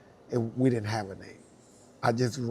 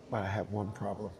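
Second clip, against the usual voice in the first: ordinary delivery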